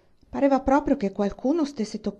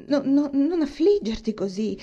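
higher, louder urgent comfort; Catherine consoling; 6 semitones higher, 5 dB louder than usual. A narrator's reading. Catherine urgently comforts Eleanor, higher and louder. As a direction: rush to reassure her friend.

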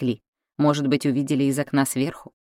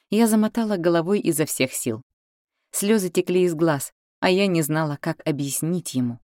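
ordinary delivery

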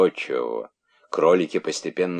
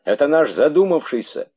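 high, loud exclamation; exclaiming; 9 semitones higher, 6 dB louder than usual. A narrator's reading. Monkey calling out before the rabbits, exclaiming.

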